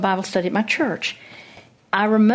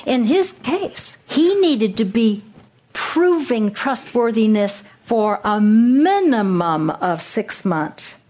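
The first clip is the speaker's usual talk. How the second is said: slow emphasis; slower than usual. Real, not acted. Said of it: "slow, weighted emphasis".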